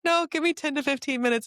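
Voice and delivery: high-pitched acted plea to wait; mimicking herself, pitched well up; retold strained reassurance. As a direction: wave off help in a high voice. Acts herself answering mid-episode.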